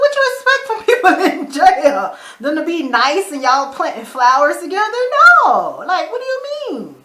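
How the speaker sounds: high, loud, laughing disbelief; incredulous mocking. Viewer mocking Jeffrey's complaint about jail, incredulous. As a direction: scoff through a laugh, voice leaping up.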